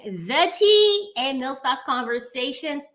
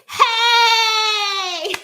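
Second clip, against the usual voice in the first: high, loud greeting shout; excited shout; 7 semitones higher, 5 dB louder, much slower than usual. Real, not acted. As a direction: call out a big hello. Greeting guest joining.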